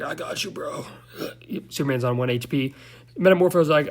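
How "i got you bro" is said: faint, strained mock croak; hammed-up feeble reassurance; weak hero voice. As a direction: imitate a drained hero barely getting words out. Weakened Superman answers in retelling.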